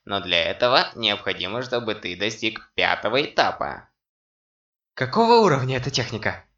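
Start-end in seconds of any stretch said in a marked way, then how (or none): 4.9-6.5 fast, eager question; impatient. A narrator's reading. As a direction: rush it out eagerly. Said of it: "нетерпеливо спросил Сяо Ян".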